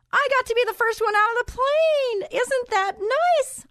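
high sarcastic "how nice"; mock cheer. Real, not acted. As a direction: sing it out sweetly while meaning the opposite. Ironic comment on going first.

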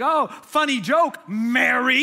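loud, high, drawn-out mock amusement; upset sarcasm. Martha jabbing at her sister from the kitchen, upset. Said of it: "She's getting upset".

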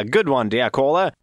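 usual speaking voice

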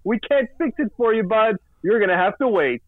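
high-pitched imitation of customer service; singsong mock brush-off; mock repair service. Imitating unhelpful internet company reply.

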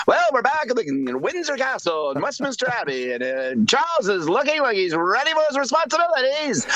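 put-on high, bright old newsreel announcer; sped-up vintage newsreel narrator; old-timey newsreel voice. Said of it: "that old-timey type voice going".

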